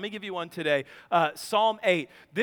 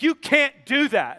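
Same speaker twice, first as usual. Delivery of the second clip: put-on indignant kid; 4 semitones higher, 6 dB louder than usual. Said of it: "and he's like".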